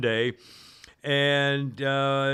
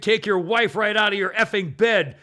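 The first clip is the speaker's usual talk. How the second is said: higher, louder retold screaming rant; mock screaming; 5 semitones higher, 6 dB louder than usual. Pot store owner screaming threats at the calm trooper, loud. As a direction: hammer him with insults. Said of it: "She's still screaming at him".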